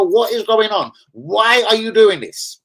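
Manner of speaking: why are you doing this, drawn out and pained; exasperated outcry. Real, not acted. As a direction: plead in disbelief at the board. Ranting at the Arsenal board.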